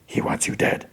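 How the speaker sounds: eerie whispered echo; haunting whisper. Retold or imitated. Haunting words echo in Maul's head.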